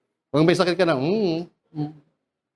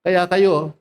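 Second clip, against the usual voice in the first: ordinary delivery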